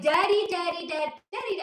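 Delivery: put-on high, pleading little-girl "daddy"; spoiled daughter. Oligarch's daughter begging her father, high and whiny. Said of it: "some rich Russian oligarch's daughter was like".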